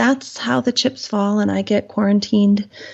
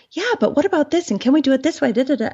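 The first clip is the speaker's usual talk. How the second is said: pushy caller; 7 semitones higher, faster than usual. Retold or imitated. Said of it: "they'd be like".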